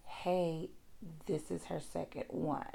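hushed warning. Retold or imitated. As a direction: tell a colleague quietly out of earshot. Doctor warned in the background.

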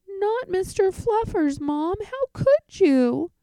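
high, loud hammed-up child wail; dramatic wail. Boy pleads with mom over his bunny, high and wailing. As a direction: melodramatic plea to save the bunny.

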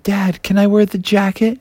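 lightly voiced kid asking dad; Froggy. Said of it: "he asked his dad".

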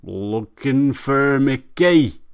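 put-on slow, slurred, drunken northern voice; drunk Arthur. Drunk Arthur answers his neighbour, slow drunken mumble. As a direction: drag the words out like a drunk searching the ground.

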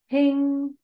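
a high, bright imitated sonar ping; ping it out high like sonar searching. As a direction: voice the sonar blip, high and ringing. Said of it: "That's the sound of the echo sonar".

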